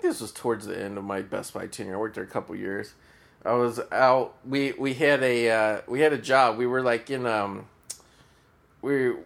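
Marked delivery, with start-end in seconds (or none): none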